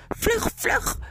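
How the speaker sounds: very high-pitched cry to hurry; urgent call. A narrator's reading. Arevis urges the horses to hurry, urgent call. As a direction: call out in haste, voice way up. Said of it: "riep Arevis".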